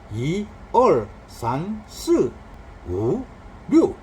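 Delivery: "이, 얼, 산, 스, 우, 류" swinging between even tones and sharp ones; alternating plain and forceful. Shown as a demonstration. Teacher showing the number rhythm, "이, 얼, 산, 스, 우, 류" alternately even and forceful. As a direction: count "이, 얼, 산, 스, 우, 류" word by word, alternately easy and punched. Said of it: "평이했다가 격해졌다가 평이했다 격해졌다 이렇게 교대로".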